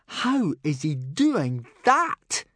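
very drawn-out, baffled moan; disbelieving groan. Acted. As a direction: drag it out in exasperated disbelief. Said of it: "groaned Snotlout".

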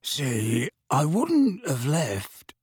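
slow, drawn-out rogue's plea; pleading. A narrator's reading. Mundungus excusing himself to Harry, pleading. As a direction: wheedle it slowly, making excuses. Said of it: "a pleading note in his voice".

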